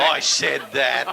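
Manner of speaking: slow, loud, high retort; emphatic insistence. Real, not acted. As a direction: hold your ground, loudly stretching the words. Insisting again to the sceptic.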